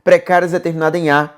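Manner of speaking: lightly voiced student objecting to the teacher; student objection. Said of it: "Você vai dizer pra mim assim".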